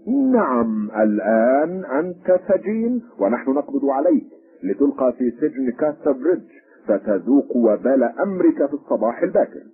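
high, forceful official arrest; sharp. A narrator's reading. Policeman arresting the third stranger, sharp and high. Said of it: "فأجابه الشرطي في حدة".

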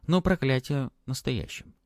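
plain reading voice

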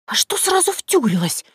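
high, defensive "сразу" stressed; embarrassed girl pushing back on "втюрилась"; flustered denial. Acted. Vasilisa, blushing, protests the word in a high flustered voice. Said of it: "Василиса покраснела, пряча глаза".

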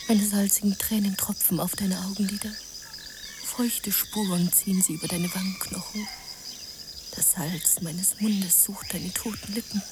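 tonlos gehaucht, zum Ende leiser und langsamer; Flüstern. Acted. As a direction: ganz nah am Gesicht flüstern. Maria über dem sterbenden Juan. (Maria flüstert über den leblosen Juan gebeugt)

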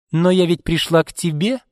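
acted spiteful jab; snide. A narrator's reading. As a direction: needle her with a sly remark. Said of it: "ехидно сказала Марго".

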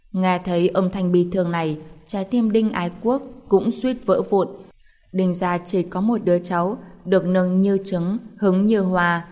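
plain reading voice

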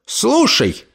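high abrupt order; staccato command. Acted. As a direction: bark it short and sharp. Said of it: "отрывисто скомандовала она".

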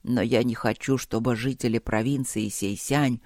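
put-on low, measured old ruler; dying emperor, resolute. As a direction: give final wishes gravely. Dying emperor speaks at the portal.

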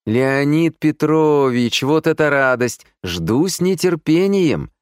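high, loud effusive welcome; gushing like a nightingale. A narrator's reading. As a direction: gush with exaggerated joy. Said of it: "а я запел соловьём". (заливаться соловьём)

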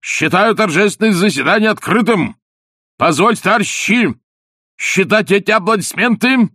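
high, loud pompous oration; shouted speech. A narrator's reading. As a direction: declaim to the crowd at full voice. Official shouts speech at crowd.

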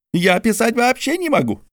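high barked retort; Mordecai barks. A narrator's reading. Mordecai still upset.

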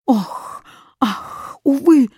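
lamenting sighs building to a high wail; moan, then burst out; woeful exclamations; crying out. A narrator's reading. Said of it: "закричал восклицательный".

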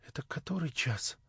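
soft, hushed question full of dread; faint and troubled; anguished whisper. A narrator's reading. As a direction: whisper it with aching distress. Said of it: "с невыразимой болезненной тоскою, смотря на меня, прошептала".

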